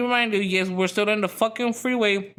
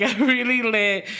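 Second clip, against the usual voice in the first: laughing; 4 semitones higher than usual. Real, not acted. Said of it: "I just started laughing".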